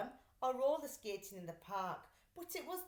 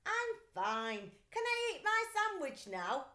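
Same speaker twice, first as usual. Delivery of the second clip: put-on high eager boy voice; hungry boy; 9 semitones higher, 5 dB louder than usual. A narrator's reading. Boy asking from inside the box, higher. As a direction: ask hopefully. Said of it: "Stanley's voice came quite clearly".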